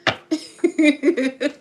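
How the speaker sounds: loud high burst of laughter. Real, not acted. Laughing at Sanita.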